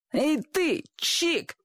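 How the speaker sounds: put-on high-pitched boyish holler; sharp rise into a boy's high yell; boy calling out. A narrator's reading. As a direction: call out cheekily like a street kid. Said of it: "крикнул мальчишка".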